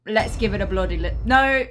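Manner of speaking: slipping into a British accent; a sudden British "no, okay". Real, not acted. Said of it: "every time I do a British accent".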